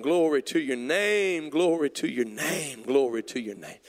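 slow, swelling cries of worship; fervent praise. Real, not acted. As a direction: cry out repeated praise, each falling away. Leads congregation in fervent praise.

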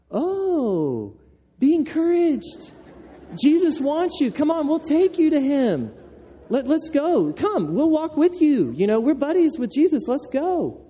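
high-pitched, over-eager cheering on; gushing encouragement. Acted. Crowd to the blind man Jesus has called, gushing encouragement. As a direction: play the suddenly helpful crowd, hammed up. Acting the suddenly friendly crowd.

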